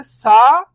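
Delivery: "सा" held long, rising at the end; stretched vowel. Shown as a demonstration. Teacher showing beginners how to read "सा", vowel stretched. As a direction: draw out the aa in "सा" with a lift. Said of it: "तो सा को खींच के पढ़ेंगे".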